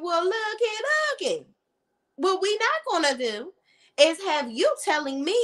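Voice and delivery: high, sassy "well looky looky" to a friend; sassy telling-off. Pretend. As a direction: put her in her place with attitude. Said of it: "And I would have been like".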